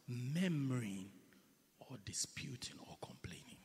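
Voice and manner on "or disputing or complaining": whispered. Shown as a demonstration. Preacher demos murmuring.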